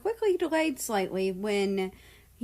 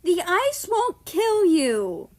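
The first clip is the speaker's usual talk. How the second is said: slow, high and loud; frowning; 10 semitones higher, 7 dB louder, slower than usual. A narrator's reading. Sovi frowns at Australia, slow and high. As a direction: scold it out plainly. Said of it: "Sophie frowned".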